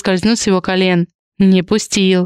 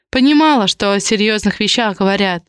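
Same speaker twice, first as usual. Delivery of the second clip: ordinary delivery, nothing marked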